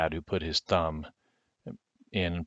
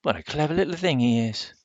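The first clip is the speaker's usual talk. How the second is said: much higher, fond countrywoman; doting country woman; 8 semitones higher than usual. Acted. Missus Owens dotes on the boy, higher. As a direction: gush over the clever child.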